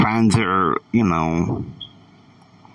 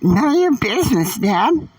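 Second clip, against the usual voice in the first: put-on much higher, sulky teen talking back; higher defiant girl voice; 12 semitones higher than usual. A narrator's reading. Daughter answering her scolding father, high defiant girl voice. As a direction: pitch up and brush Dad off.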